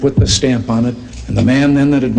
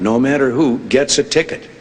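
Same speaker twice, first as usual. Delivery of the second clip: normal talking voice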